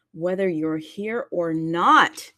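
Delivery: ordinary talk, nothing marked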